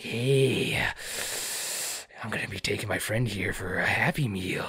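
whispery, creepy villain imitation; hushed, hammed-up creepy voice; shitty creepy voice. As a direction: imitate a creep with a bad put-on voice. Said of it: "that voice fucking accent he tried having".

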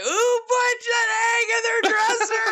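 high, loud mock shriek of an irate French granny; French grandmother losing it. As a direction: imitate an outraged grandmother with a French accent. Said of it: "in her French accent or whatever".

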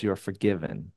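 gentle pardon. Retold or imitated. God's gentle pardon voiced.

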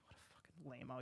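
hushed whispered aside; whispering. Real, not acted. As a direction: whisper it under your breath. Aside mocking art museums.

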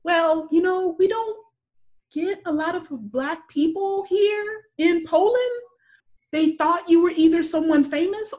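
imitating an embarrassed woman explaining; tour guide. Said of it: "And she goes".

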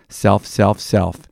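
normal talking voice

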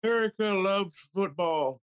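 high, slow, put-on orator; drawn-out high speech voice; mock presidential speech. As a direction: imitate a doddering politician rallying fans. Mock presidential Super Bowl speech.

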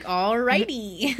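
drawn-out, wary "alrighty"; slow alrighty. Retold or imitated. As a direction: stretch it out, a little taken aback. Boy reacts to threat, drawn out.

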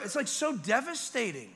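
high, loud overwrought complaint; comic distress. Acted. Father holding a wailing baby, high and distraught. After imitating the wailing child.

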